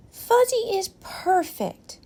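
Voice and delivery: put-on high, slow child's voice; a small boy insisting lovingly; little boy voice. A narrator's reading. Owen defends his blanket, high and slow like a boy.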